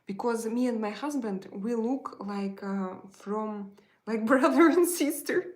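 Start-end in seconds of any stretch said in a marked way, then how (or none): 4.1-5.4 higher, much louder, laughing; real amused burst, pitched up. Real, not acted. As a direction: laugh through the words. Laughs at her own comparison.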